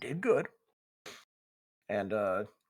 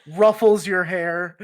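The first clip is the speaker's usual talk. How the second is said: laughing; 6 semitones higher, 13 dB louder, slower than usual. Real, not acted. Teasing, laughing out loud.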